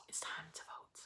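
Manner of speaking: hushed, breathless whisper. Retold or imitated. Host Claudia hushing the table before the vote, whispered. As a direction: drop to a secret whisper.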